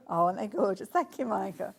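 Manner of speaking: ordinary talk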